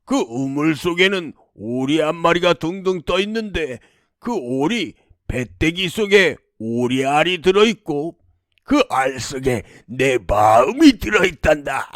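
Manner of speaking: measured demon voice, each detail spelled out; clearly explaining demon. Acted. Demon tells princess where his heart lies, clearly. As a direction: reveal the secret piece by piece, with relish. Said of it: "똑똑히 알려주었습니다".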